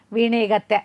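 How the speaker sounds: ordinary delivery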